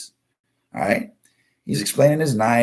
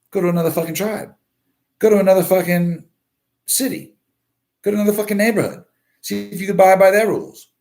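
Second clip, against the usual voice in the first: building rant; 9 semitones higher, faster than usual. Real, not acted. Ranting host pushes viewers to leave, building up. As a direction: hammer the same order home, climbing each time.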